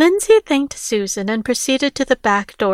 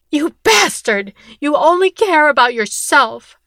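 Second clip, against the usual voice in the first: furious outburst; 4 semitones higher, 4 dB louder than usual. Acted. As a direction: hurl it at him as you attack. Victor attacks Rick.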